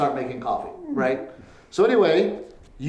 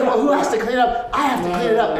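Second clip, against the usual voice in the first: laughing nag; 7 semitones higher, 4 dB louder than usual. Retold or imitated. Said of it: "she's like".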